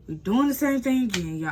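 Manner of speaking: fed up and letting it show; exasperated. Real, not acted. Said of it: "I'm still mad".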